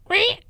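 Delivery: very high gleeful squeal; gleeful whoop. Retold or imitated. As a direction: let out a giddy victory squeal. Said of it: "and just went".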